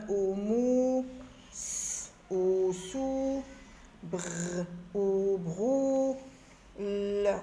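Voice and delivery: each sound drawn out separately, then joined with "ou" or "o"; teacher slowly blending sounds into syllables. Shown as a demonstration. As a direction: hold R, N, M, S, L, then blend into "rou", "nou", "lou". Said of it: "forme et lit des syllabes".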